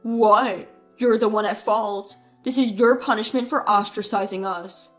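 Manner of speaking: loud scornful retort; defiant brother. Acted. Brother blames the bride at her wedding, loud. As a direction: throw the blame back.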